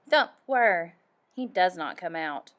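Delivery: ordinary delivery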